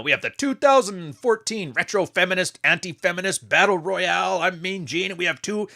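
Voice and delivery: lightly hammed-up nineties wrestling announcer. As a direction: hype the match like Mean Gene. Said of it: "your nineteen ninety-three W W F voice".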